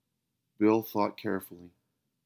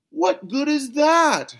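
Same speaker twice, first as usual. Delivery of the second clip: much higher, loud, slow outburst; shout; 9 dB louder, slower than usual. Acted. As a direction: yell it out in frustration. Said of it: "shouted Bill".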